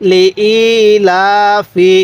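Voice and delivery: syllables spaced out with long "i" and "la"; slow model reading with held vowels; one-alif stretch. Shown as a demonstration. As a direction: stretch the vowels of li-ila-fi evenly. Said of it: "এক আলিফ টেনে পড়ব".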